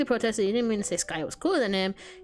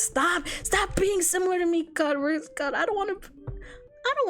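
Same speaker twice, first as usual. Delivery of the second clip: high-pitched exasperated outburst; worked-up, squirming plea; 12 semitones higher, slower than usual. Real, not acted. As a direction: protest in a high voice, half pleading. Exasperated at the relatable character.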